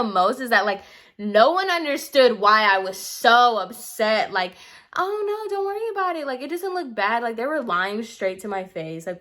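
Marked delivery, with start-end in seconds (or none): 5.0-7.2 mock sugary reassurance, much higher pitch; fake reassurance. Mocking classmates who claim her ruined hair looks fine, high fake-sweet voice. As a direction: parrot their fake comfort in a high sweet voice.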